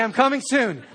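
high, loud, playful call; voicing Jesus. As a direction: announce it brightly, like a phone caller. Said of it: "Jesus calling right now to say".

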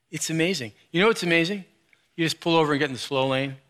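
usual speaking voice

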